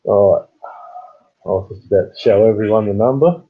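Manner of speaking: usual speaking voice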